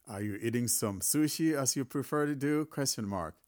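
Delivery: usual speaking voice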